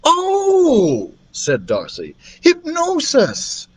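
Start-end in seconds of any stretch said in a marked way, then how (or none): 0.0-1.1 sudden high gasp of realization; a startled high "Oh"; surprised oh. Acted. Darcy realizing aloud, high and surprised. 2.4-3.7 high, slow, drawn-out answer; voiced girl Darcy. Darcy answering the class, high and slow.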